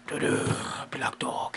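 hushed dramatic whisper reveal. Pretend. Whispered reveal of ghost dog.

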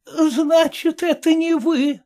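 put-on high, slow, incredulous old dame; lightly voiced shocked old woman; startled old lady. A narrator's reading. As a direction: gasp it out in disbelief. Old lady realizing mistake.

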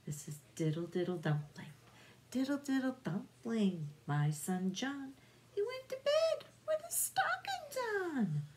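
ordinary delivery, nothing marked

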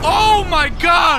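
loud, high, drawn-out cry; shocked shout. Real, not acted. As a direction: yell it in disbelief, stretching it out. Startled shout during the game.

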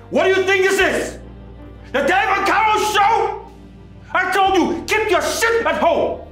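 furious boss yell. Acted. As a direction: rage at them for dragging home into the office. Boss yells at quarreling couple.

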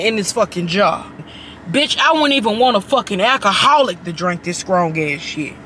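ordinary talk, nothing marked

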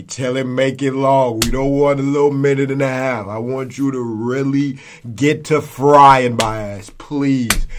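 eager pleading. Real, not acted. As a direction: push it out, hyped and insistent. Begging viewers for a long roast.

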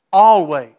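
one loud, final "Always."; emphatic Always. Real, not acted. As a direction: hit "Always." hard from the pulpit. Preacher stresses God's promise.

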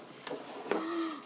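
sharp delighted gasp; high-pitched gasp of wonder; surprised gasp. Real, not acted. Opens surprise gift.

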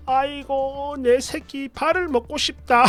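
high, slow doting-granny voice; playful grandmother. Retold or imitated. Tipsy grandmother dotes on sleeping grandchild, playful high cooing. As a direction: coo over the baby, soft and drawn out. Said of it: "기분이 좋아지고 장난기가 발동했나 봅니다".

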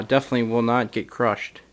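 usual speaking voice

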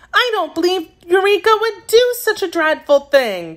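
put-on high girlish voice in distress; distressed cry. A narrator's reading. Little girl defending her kitten to the others, distressed. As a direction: protest in a high, upset voice. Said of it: "much distressed".

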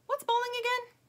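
high, loud character voice; puzzled toy buffalo. A narrator's reading. Lumpy asks Stingray about bowling, puzzled.

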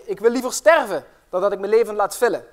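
stubborn parson shouting from his sickbed; shouted from his bed. Acted. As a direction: yell it in refusal, stubborn and defiant. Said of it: "schreeuwde hij uit zijn bed".

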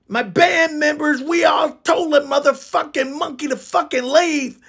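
usual speaking voice

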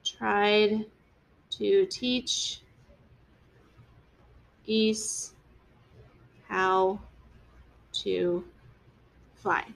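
very slow, word by word with long pauses; slow dictation. Shown as a demonstration. Teacher dictating the sentence to the class while writing, slow and word by word. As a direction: spell it out slowly as you write it on the board. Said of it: "I'm gonna say that Bruce".

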